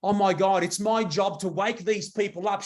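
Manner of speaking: ordinary talk, nothing marked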